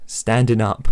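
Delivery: normal talking voice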